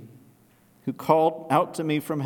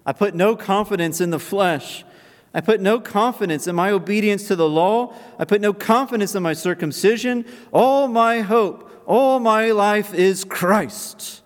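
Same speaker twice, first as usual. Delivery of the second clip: preaching at full force; 6 semitones higher, 5 dB louder than usual. Acted. Preaching builds to a climax.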